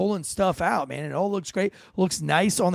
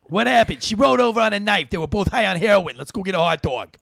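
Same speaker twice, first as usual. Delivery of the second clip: mock New York cop; 2 semitones higher, 4 dB louder than usual. Podcaster mocking a New York cop's shrugging report. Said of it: "All the New Yorkers that are".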